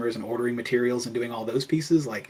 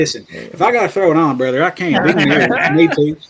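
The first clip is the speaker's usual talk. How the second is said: playful put-on Southern accent, louder and higher; 4 semitones higher, 12 dB louder than usual. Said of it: "Your accent will kick in someday".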